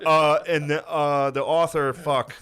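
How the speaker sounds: high, loud, halting pupil's presentation; kid giving book report. Acted. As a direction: stumble through it like an unprepared student. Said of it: "book report day".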